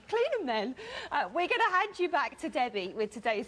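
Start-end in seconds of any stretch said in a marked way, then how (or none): 0.1-0.7 laughing repeat. Real, not acted.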